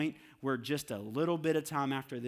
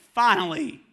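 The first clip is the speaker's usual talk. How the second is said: relieved Lord; 6 semitones higher, 11 dB louder than usual. Retold or imitated. The Lord's voice.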